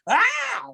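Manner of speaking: sudden high-pitched mock scream; angry freaked-out scream. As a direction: burst out in a short, very high cry. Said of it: "when he gets really angry".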